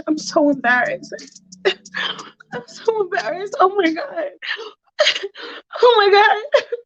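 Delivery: high, breaking, embarrassed wail; mock crying. Allison humiliated before Michael, high acted crying. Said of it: "And she starts crying".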